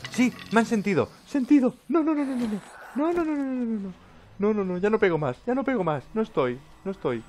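high frantic string of no, no, no; panicked. Real, not acted. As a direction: squeal in panic as they come. Monsters heard him, panicking. (pánico agudo: ¡no, no, no!)

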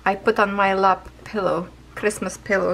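ordinary delivery

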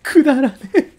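high, loud groan of disbelief; くだらねえ thrown out loud and high in exasperation; disgusted groan. Real, not acted. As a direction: groan at a terrible pun. Groans at dog's pun.

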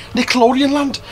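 bad Liverpool Scouse impression; Scouse accent. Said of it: "in that terrible Scouse accent".

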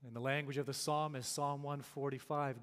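ordinary delivery

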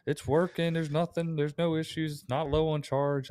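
high mocking voice of techs insisting it works; lightly voiced clueless techs denying the problem; mocking denial. Mocking the other company's techs.

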